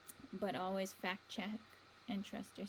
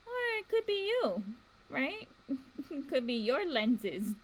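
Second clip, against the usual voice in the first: amused; 5 semitones higher, 6 dB louder than usual. Real, not acted.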